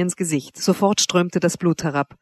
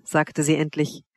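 plain reading voice

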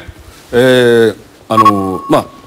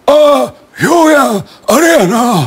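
lightly voiced dopey drawl; loose-lipped spacey speech; a hint of a slow, dopey senior; slack senior's speech; 6 semitones higher, much slower than usual. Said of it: "っていうような喋り方なんです".

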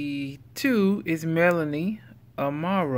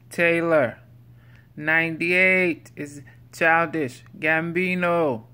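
odd countdown voice; 4 dB louder than usual. Pretend. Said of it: "I don't know why I began to talk like this".

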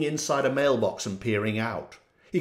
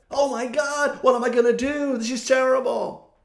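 higher, hammed-up panicky voice; mock panic; 9 semitones higher than usual. Jordan panicking after walking into smoke, mock distress. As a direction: fret like he's lost.

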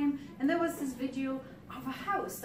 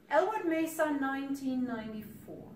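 raised, ringing announcement; poem heading; 3 semitones higher, 5 dB louder than usual. Acted. Poet opens on the grove in its thriving year, ringing announcement. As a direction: announce place and year high and loud.

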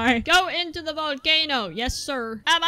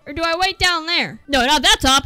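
ordinary talk, nothing marked

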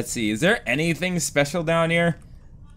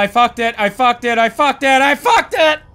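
each repeat higher and louder up to a yelp; building frustration; 6 semitones higher, 4 dB louder than usual. Real, not acted. Gamer failing the puzzle, rising frustrated yell. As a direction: let frustration climb.

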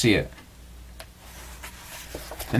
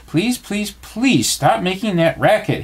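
high plea with a drawn-out please; pleading; 8 semitones higher, slower than usual. A narrator's reading. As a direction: beg for it, pitch up, stretching the words. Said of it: "he pleaded".

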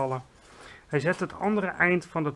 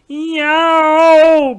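long, high, very loud roar of pain; roaring howl; 11 semitones higher, 18 dB louder than usual. A narrator's reading. Giant roars as he writhes on the ground, loud high howl. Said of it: "brulde de vleeslapeter".

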